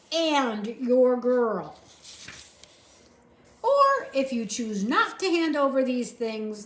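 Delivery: stern soldier. A narrator's reading. Soldier telling Uncle the law, stern and loud. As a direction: lay down the law firmly.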